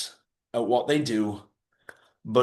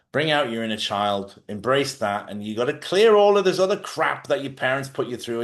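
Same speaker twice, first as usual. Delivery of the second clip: ordinary talk, nothing marked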